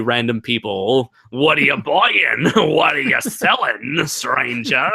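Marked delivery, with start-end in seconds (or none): 1.3-4.9 slow, higher put-on Cockney; hammed-up Cockney hawker, stretching the words; Cockney merchant. Imitating merchant selling to random people, Cockney. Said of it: "pretending to sell items to".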